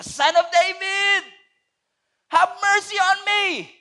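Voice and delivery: slow, high, loud desperate plea; blind man's cry. Retold or imitated. Blind Bartimaeus begging Jesus, slow loud high cry. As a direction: cry out for mercy, drawn out. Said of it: "sigaw siya ng sigaw".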